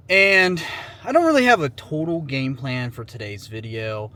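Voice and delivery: ordinary talk, nothing marked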